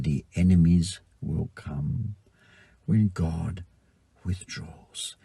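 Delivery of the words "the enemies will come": ordinary talk, nothing marked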